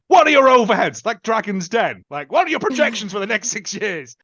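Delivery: hammed-up Dragon's Den interrogation; mock grilling. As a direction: fire questions like a tough investor. Voicing Riley grilling staff.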